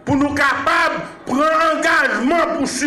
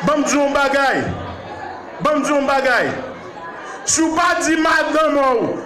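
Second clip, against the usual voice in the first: fiery preaching; 4 semitones higher than usual. Acted. Preacher exhorting his church, full-force fiery preaching. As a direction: preach it hard to the congregation.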